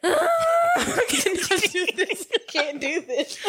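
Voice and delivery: laughing helplessly, words stretched out slowly; can't get through it for laughing; real cringe laughter. Real, not acted.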